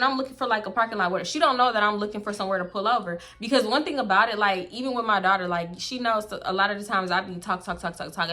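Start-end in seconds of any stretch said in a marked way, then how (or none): none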